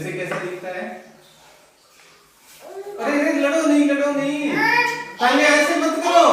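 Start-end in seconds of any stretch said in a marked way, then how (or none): 3.0-6.3 loud, high, urgent shouts of stop; real alarm, shout them apart; breaking up a fight. Real, not acted. Stopping children fighting over toys.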